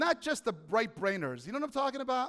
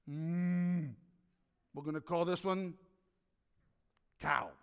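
God musing, lower; 4 semitones lower, 3 dB quieter than usual. Pretend. God names the cow, lightly voiced musing. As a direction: mull it over like naming a pet. Voicing God naming animals.

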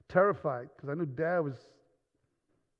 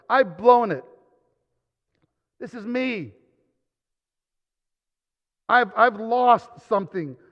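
high, loud, fervent sermon voice; preaching at full force; 8 semitones higher, 11 dB louder than usual. Acted. Preacher drives the point home to the congregation, loud and high.

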